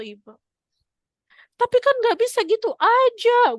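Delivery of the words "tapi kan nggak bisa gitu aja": high, loud whiny objection; mother refusing to let go of the fraud, high and loud; protesting woman. Retold or imitated. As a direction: whine it out as the reluctant woman.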